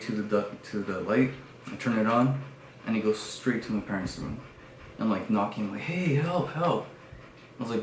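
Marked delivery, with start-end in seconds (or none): 5.8-6.9 high, urgent calling; acted panicked plea; retold cry for help. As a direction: call out for help through a door. Calling parents through door.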